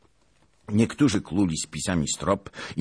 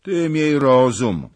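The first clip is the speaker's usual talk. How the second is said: loud, slow character warning; a grim warning to the falling cossack; stern warning; 8 semitones higher, 10 dB louder, slower than usual. Acted. Warning the cossack, loud and slow.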